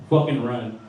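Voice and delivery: ordinary delivery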